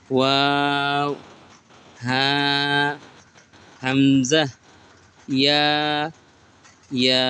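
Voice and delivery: letter names stretched long, one by one; slow, stretched Arabic letter names; drawn-out recitation. Shown as a demonstration. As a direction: draw out each letter name like a Quran teacher. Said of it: "আমার মতো করে".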